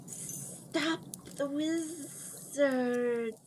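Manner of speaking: very slow, soft, distant whisper; hissing whisper. A narrator's reading. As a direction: breathe it out slowly. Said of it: "whispered the soft".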